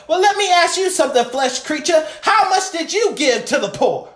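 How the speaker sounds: high-pitched, rapid preacher's rebuke; indignant challenge from the pulpit; fired-up challenge. Real, not acted. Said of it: "now the apostle is rising in me".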